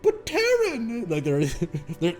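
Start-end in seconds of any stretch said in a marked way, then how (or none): 0.0-1.0 a stretched, very high plea, slowly drawn out; desperate high cry. Acted. Stranded friends cry out as Terran walks away, high desperate plea. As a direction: cry out to stop him leaving.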